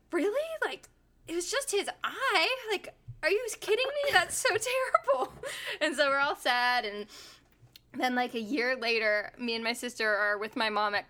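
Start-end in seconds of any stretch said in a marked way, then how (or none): 0.1-5.3 high, fast incredulous questions; can't believe it, half laughing; disbelief. Retold or imitated. Kid hears dog died after eye injury, high disbelief. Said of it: "And I was like".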